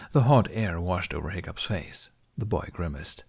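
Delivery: ordinary delivery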